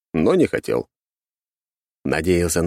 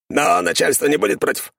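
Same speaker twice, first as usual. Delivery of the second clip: skeleton servant voice; 12 semitones higher than usual. A narrator's reading. Skeleton glances at guest.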